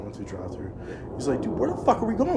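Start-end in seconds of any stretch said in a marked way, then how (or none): 1.4-2.4 confused friend. Retold or imitated. Friend's confused question retold.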